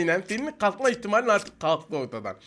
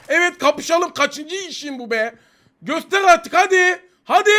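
loud, higher, impatient demand, slow on the last line; frustrated; 7 semitones higher, 6 dB louder, slower than usual. Real, not acted. Gamer demanding the enemy show himself, exasperated and loud. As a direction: urge the hidden enemy out, fed up. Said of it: "sinir krizine soktun beni".

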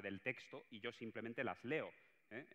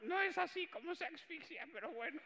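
mock high aside. Comic aside to the audience in a high put-on voice. Mocking aside after the dog bit.

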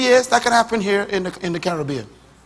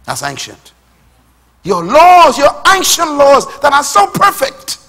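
loud, high, fervent preaching; preaching at full force; 8 semitones higher, 9 dB louder than usual. Acted. Preacher proclaiming to his church, loud and fervent. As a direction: drive it home to the congregation.

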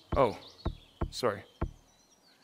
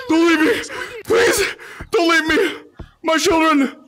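mock plea; 7 semitones higher, slower than usual. Leaving a lobby, mock plea.

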